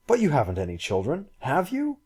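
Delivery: taken aback, voice jumps up on the tag question; astonished. A narrator's reading. Man learns of her children, astonished. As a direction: question leaping high at the end. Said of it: "He was purely astonished".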